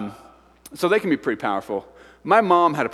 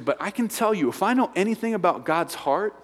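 ordinary talk

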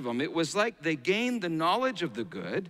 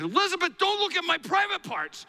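put-on high-pitched, loud child's protest; indignant little boy; 13 semitones higher, 3 dB louder than usual. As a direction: imitate an embarrassed four-year-old, high and loud. Preacher voices his young son's protest.

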